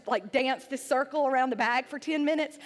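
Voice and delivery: ordinary delivery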